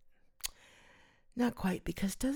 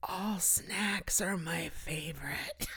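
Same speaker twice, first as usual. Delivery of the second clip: slow, soft guilty whisper; slow conspiratorial whisper; 4 semitones higher, 7 dB quieter, slower than usual. Real, not acted. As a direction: confess it under your breath. Confessional joke aside.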